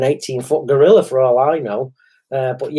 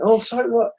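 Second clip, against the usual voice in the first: put-on surprised girl; 9 semitones higher than usual. Girl surprised he's blind.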